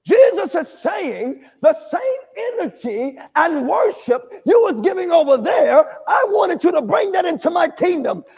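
building, emphatic sermon; full-force preaching. Acted. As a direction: preach it with fire.

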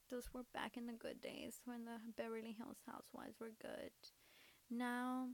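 ordinary talk

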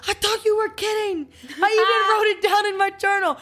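high, hammed-up silly movie voice; Will Ferrell movie quote. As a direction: quote the film in a squeaky, goofy voice. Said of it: "that Will Ferrell movie that was like".

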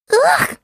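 put-on very high, loud baby wail. A narrator's reading. Baby owl refusing her food, high protesting wail. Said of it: "Wailed Baby Owl".

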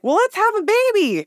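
high chirpy nurse voice; cheerful nurse. Retold or imitated. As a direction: announce it brightly and high.

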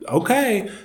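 high-pitched comic give-up; mock reluctant okay. Deputy giving up on the man who refuses rescue, mock reluctant.

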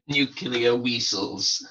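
slow, high mock Russian accent; Chekhov Russian accent. Quoting Star Trek's Chekhov, slow mock Russian accent. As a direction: stretch it like Chekhov's dodgy Russian. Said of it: "It sounds like Chekhov".

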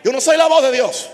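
loud, high-pitched preaching shout; impassioned cry. Real, not acted. Preacher disclaiming to the church, high impassioned shout. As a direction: proclaim it at full force.

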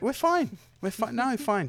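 high, overly insistent denial; defensive. Acted. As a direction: cover up quickly. Caught after scuffle, covering.

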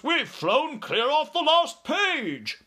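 high, drawn-out, exasperated outburst; outraged cry. A narrator's reading. Air Force chief off the edge of the map, outraged cry. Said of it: "cried the head of the Air Force".

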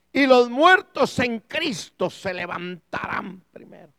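loud, slowed, declaimed Bible verse; forceful scripture reading. Real, not acted. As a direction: proclaim the verse with full preacher's force. Reads the Bible verse aloud to congregation.

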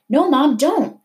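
high urgent warning; crying out. A narrator's reading. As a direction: desperately stop her from reaching. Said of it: "Mahmoud cried".

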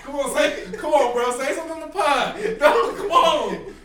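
high, loud laughing plea. Real, not acted. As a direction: laugh through the words. Laughing while urging friend to talk.

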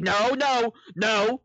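high-pitched imitation of refusing; mock defensive refusal. Co-host imitating the host refusing help, mock defensive.

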